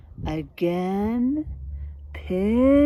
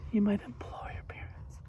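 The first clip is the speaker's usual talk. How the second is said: whisper; 8 dB quieter than usual. Real, not acted. Aside to class.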